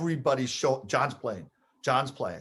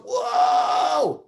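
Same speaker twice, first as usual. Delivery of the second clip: high, loud shouted whoa; excited whoa; 10 semitones higher, 5 dB louder than usual. Retold or imitated. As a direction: yell it in pure amazement. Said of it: "Alpo goes".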